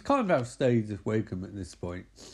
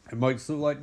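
ordinary delivery

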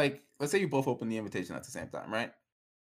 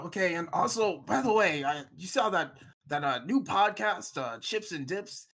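grandpa voice; 7 semitones higher than usual. Retold or imitated. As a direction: lightly play the chatty grandpa. Voicing grandpa on the phone.

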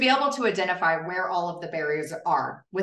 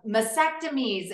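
ordinary delivery

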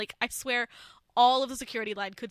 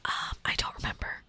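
ASMR whisper. Pretend. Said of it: "A S M R style".

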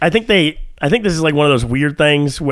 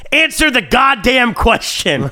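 high, slow, stressed "Answer the goddamn question"; all caps demand; 8 semitones higher, slower than usual. Retold or imitated. To the scammer texter, a slow all-caps demand. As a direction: spell out the caps with each word. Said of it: "in all capital letters".

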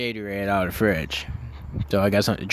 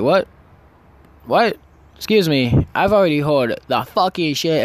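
higher, mildly affronted retort; retold indignant comeback; 5 semitones higher, 3 dB louder than usual. Storyteller getting up to face the mom, retold indignant comeback. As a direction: replay an indignant challenge, pitched up.

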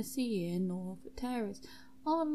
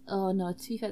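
ordinary talk

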